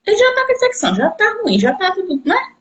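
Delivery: insistent high-pitched persuasion; urgent coaxing. Retold or imitated. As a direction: talk her into it, high and eager. Urging the person to try it.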